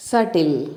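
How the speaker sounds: సటిల్ with the b silent; correct pronunciation. Shown as a demonstration. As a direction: say సటిల్ as "SAT-il", no b sound. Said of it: "ఎలా పలకాలి?".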